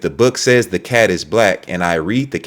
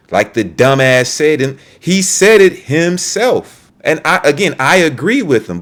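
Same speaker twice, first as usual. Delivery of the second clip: heated rant; 7 semitones higher, 4 dB louder than usual. Real, not acted. Heated rant about critic.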